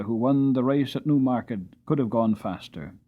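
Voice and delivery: ordinary delivery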